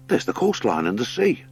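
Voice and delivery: high, eager voice reading a drawing; excited hare. Acted. Hare interprets the heron's sketch, high eager voice.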